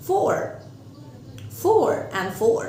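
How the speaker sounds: "four and four" said slowly; slow demo. Shown as a demonstration. Teacher modelling four and for. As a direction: space out "four and four". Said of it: "وعندي four".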